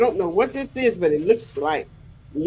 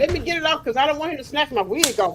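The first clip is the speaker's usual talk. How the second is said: excited panic; 7 semitones higher, faster than usual. Real, not acted. Husband coming up stairs.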